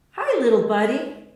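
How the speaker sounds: light up at the visitor; patient greets the little boy, cheerful; happy greeting. A narrator's reading. Anna greeting from hospital bed.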